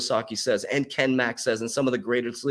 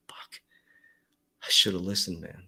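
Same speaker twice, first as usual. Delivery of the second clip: whispered regret; 8 semitones lower, 6 dB quieter than usual. Retold or imitated. Imagined regretful viewer in future.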